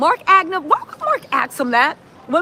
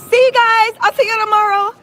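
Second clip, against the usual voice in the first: high, loud sing-song farewell; mock goodbye; 7 semitones higher, 6 dB louder than usual. Host jokes about leaving the hearing, loud high goodbye. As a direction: pretend to walk out waving bye.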